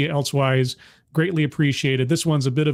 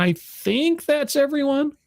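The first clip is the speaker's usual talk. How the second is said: much higher, slightly drawn-out pitch; voice shooting up; 12 semitones higher, slower than usual. Real, not acted. Host finishing the chat greetings, voice shooting up. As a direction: let the voice climb way up as you stretch it. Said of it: "my voice goes up a couple of extra octaves".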